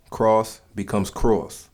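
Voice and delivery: very slow demo, second "cross" drawn out like "crawss"; New York vowel. Shown as a demonstration. Teaching the New York O sound, slow "crawss" demo. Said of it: "the use of the letter O, like an A U".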